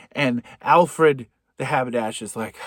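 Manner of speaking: ordinary delivery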